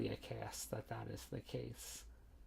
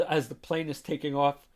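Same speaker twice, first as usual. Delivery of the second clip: ordinary talk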